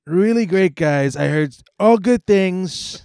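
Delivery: high, loud, slow Trump voice; Trump impression. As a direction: Trump hyping the podcast. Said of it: "can you make Trump say".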